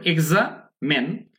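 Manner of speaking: এক্সামেন split slowly as "egza, men"; slow, pause before the "men" of এক্সামেন; syllable-split pronunciation. Shown as a demonstration. Teacher asking learners to notice, syllable-split pronunciation. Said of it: "দেখুন খেয়াল করুন".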